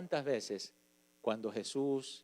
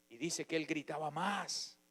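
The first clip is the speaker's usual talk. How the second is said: ordinary talk, nothing marked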